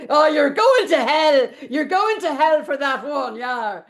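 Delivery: loud, laughing mock doom; mock condemnation. Real, not acted. Joking to her friend she is going to hell, loud. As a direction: tease with a gleeful warning.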